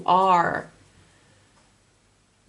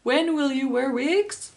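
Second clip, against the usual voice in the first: mimicking spirit question; 5 semitones higher than usual. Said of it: "I'm getting that".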